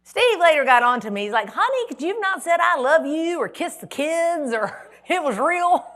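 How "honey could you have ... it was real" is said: higher and louder, slowing at the end and breaking into a laugh; lightly voiced husband, hammed-up and laughing; mock husband scolding. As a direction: play the exasperated husband half laughing.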